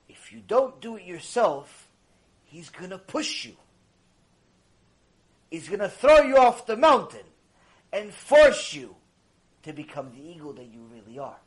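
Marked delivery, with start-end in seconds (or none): none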